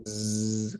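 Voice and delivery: long soft humming z; sustained voiced z, softly; bee-like zzz buzz. Shown as a demonstration. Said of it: "como una abejita".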